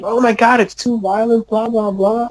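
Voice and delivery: high-pitched mocking whine of outrage; mock oversensitive people. Podcaster mocking game-trailer critics, high-pitched mock outrage. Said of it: "people are so sensitive".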